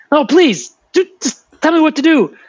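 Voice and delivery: high, squeaky desperate bird voice; frantic high-pitched raven pleading with the wolf; begging raven. Retold or imitated. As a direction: beg in a high panicky little voice. Raven begging in retold tale.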